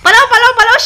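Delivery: playful hurry-up call to run; mock alarm. Mother to toddler, playing at fleeing a toy snake, mock alarm.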